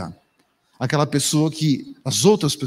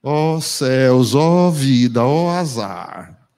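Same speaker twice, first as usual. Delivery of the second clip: low, slow, hopeless sigh; gloomy Hardy lament; 5 semitones lower, much slower than usual. Retold or imitated. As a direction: drag it out like a doomed cartoon hyena. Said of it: "Lembra do lip hard?". (lamento arrastado e grave, sem esperança)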